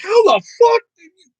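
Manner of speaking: very high, loud, cracking how the fuck; shrieking disbelief. Retold or imitated. Kid stunned by mom's answer, shrieking disbelief. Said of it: "I'm like".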